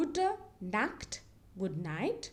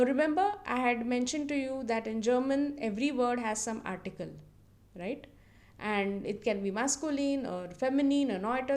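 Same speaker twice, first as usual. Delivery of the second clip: ordinary delivery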